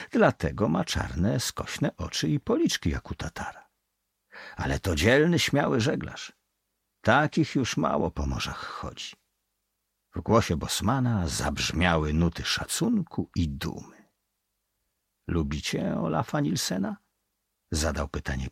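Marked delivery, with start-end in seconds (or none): none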